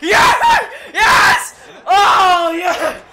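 screamed urging breaking into ecstatic cheers; loud, high yells, laughing by the end; frantic to triumphant. Real, not acted. Frantic urging turns to celebration.

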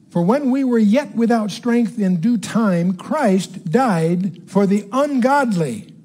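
slow, measured Bible reading in separated phrases; emphatic scripture reading. Acted. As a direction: read the verse out deliberately, phrase by phrase. Reads scripture aloud to teach.

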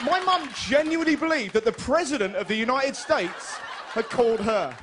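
usual speaking voice

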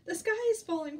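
high, loud alarmed cry; hammed-up breathless chick; panting alarm. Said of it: "panted Chicken Little".

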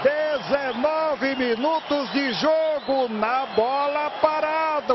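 long drawn-out goal shout; explosive goal commentary. Real, not acted. Goal call by narrator.

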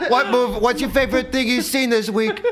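high-pitched mock threat; squeaky knife-wielding thug; menacing high voice. Imitating knife-wielding host.

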